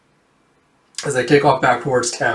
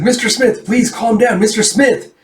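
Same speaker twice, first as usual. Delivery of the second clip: high-pitched, insistent appeal; urgent soothing attempt; 8 semitones higher, 4 dB louder than usual. Begging agitated man to calm down, insistent. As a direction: plead in a raised pitch for calm.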